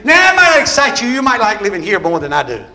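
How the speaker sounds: loud, high whooping preacher; sermon climax, preacher whooping to the congregation; preaching at full force. Acted. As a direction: shout it to the rafters like a revival preacher.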